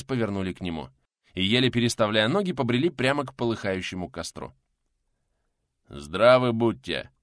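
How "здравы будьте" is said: coughing greeting. Acted. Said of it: "Сквозь кашель поприветствовал".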